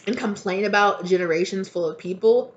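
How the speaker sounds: normal talking voice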